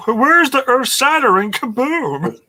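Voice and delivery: high, slow cartoon alien voice; cartoon quote. Quoting a cartoon about the sparkler, high and slow. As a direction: ham up the cartoon Martian line. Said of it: "Marvin the Martian quote".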